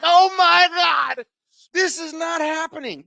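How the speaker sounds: high, drawn-out exclamation easing off in volume; mock dismay. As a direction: wail it high in disbelief. Retold dismay at the heckle.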